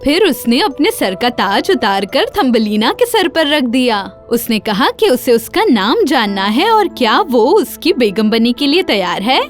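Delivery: ordinary delivery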